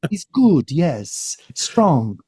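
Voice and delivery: mum's put-on British accent, lightly imitated. Son imitating his mum's British accent praising someone. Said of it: "trying to do an accent".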